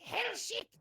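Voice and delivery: high, hammed-up dictator shout; mock dictator yell. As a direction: bark it like a ranting dictator. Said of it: "He'd be like".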